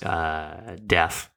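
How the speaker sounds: normal talking voice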